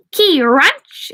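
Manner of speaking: high, loud screech; shrieking vampire cry; vampire scream. A narrator's reading. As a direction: scream it like a monster. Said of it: "it screamed".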